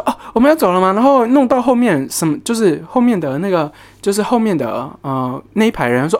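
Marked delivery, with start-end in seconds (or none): 0.0-0.9 coworker blurting it out loud; a loud, careless call across the room; mock loud question. Clueless coworker loudly announcing they're leaving. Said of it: "然后他很大声的说".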